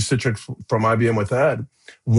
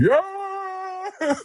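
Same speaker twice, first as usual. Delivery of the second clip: very high whooping yell; retold screaming cheer; 5 dB quieter than usual. Team peeling out in rental cars, high whooping yell. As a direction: yell it out of a car window. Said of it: "as we screamed".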